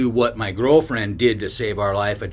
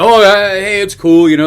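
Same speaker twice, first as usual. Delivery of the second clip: surprised stranger; 8 semitones higher, 11 dB louder than usual. Retold or imitated.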